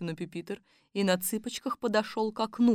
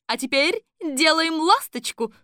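coach command; 9 semitones higher, 7 dB louder than usual. A narrator's reading.

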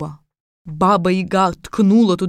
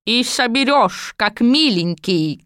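put-on scolding old woman; 4 semitones higher, slower than usual. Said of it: "крикнула старуха".

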